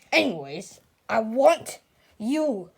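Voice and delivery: normal talking voice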